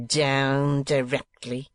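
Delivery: slow, scornful schoolmaster; lightly voiced bully of a schoolmaster; Squeers's voice. A narrator's reading. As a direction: drag it out with contempt. Squeers mocks Nicholas's words.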